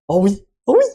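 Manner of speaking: high, loud excited yelling. Real, not acted. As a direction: shriek with excitement. Balloon inflating fast.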